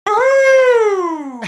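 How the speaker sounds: high, loud "woo-hoo"; big excited whoop; trademark whoop. Real, not acted. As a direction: let out a long, high show-opening whoop. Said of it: "There it is, the trademark".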